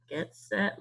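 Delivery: ordinary talk, nothing marked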